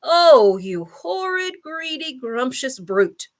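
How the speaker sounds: high outburst, loud on the first word; indignant cry. A narrator's reading. Humpy Rumpy insults the crocodile, crying out indignantly. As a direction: scold in drawn-out, high-pitched outrage. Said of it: "cried Humpy Rumpy".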